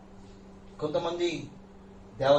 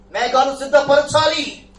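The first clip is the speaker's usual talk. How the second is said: higher, louder and slow; loud slow declaration; 8 semitones higher, 10 dB louder, much slower than usual. Acted. Preacher commanding the church, loud slow call. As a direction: lean in and drive it home.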